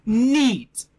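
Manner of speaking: high, loud, drawn-out word; delighted exclamation. Real, not acted. Exclaiming after the pronunciation fuss.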